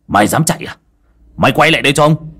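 rising to a loud angry yell; angry cursing. Acted. Master yelling after the escaping ghost, angry. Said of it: "cậu dậm chân chửi thề".

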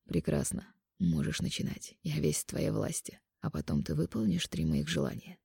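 soft, low man's voice; quiet intimate male lover. Acted. Mark surrenders playfully.

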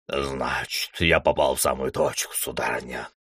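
a hint of a smug cat. A narrator's reading. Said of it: "рассмеялся весельчак".

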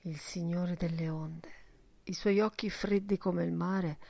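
ordinary delivery, nothing marked

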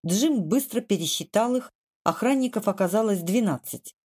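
plain reading voice

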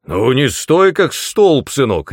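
loud, high barked order; barking. A narrator's reading. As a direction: bark impatiently at a dawdler. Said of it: "рявкнул Ас".

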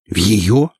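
mildly puzzled, questioning rise; bewildered. A narrator's reading. Andrei hears whose bag held the gun, bewildered. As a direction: ask it with a touch of bewilderment. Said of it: "недоумённо спросил Андрей".

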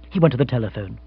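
usual speaking voice

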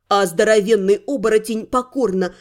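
ordinary delivery

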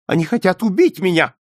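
high, loud frightened accusation; hurried alarm. A narrator's reading. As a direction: blurt it out in alarm. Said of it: "быстро проговорил он". (испуганно выпалить)

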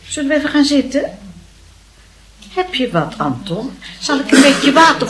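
high, loud concern; worried fussing. Acted. Aunt offers pale nephew water, worried fussing. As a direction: fuss over him with rising worry.

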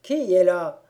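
booming angry repeat. A narrator's reading. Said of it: "demanda une seconde fois".

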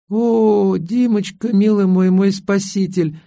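high, slow swooning sigh; languid swoon. A narrator's reading. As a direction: swoon over your rescuer. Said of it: "томно прошептала красавица-мулатка". (томно, высоко и протяжно)

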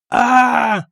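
high drawn cry of pain; pained yell. A narrator's reading. Pirate hit in thigh by arrow, yelling. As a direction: yell out as if struck. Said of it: "заорал разбойник".

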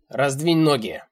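loud, sharp command; yelled order. A narrator's reading. Old man orders the narrator, yelling. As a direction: shout the order at her. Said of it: "орёт он".

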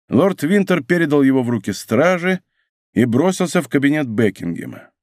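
ordinary delivery

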